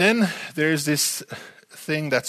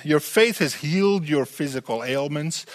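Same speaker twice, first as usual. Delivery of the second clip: normal talking voice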